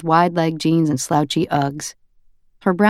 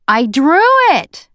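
put-on high, loud, slow indignant little boy; insistent toddler; 11 semitones higher, 6 dB louder, slower than usual. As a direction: protest like a cross three-year-old. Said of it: "forehead buckled into a frown".

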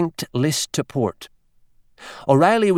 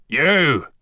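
a hammed-up booming roar of greeting; 3 semitones higher than usual. A narrator's reading. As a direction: bellow the single word across the bar. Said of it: "roared Doctor Fingal Flaherty O'Reilly".

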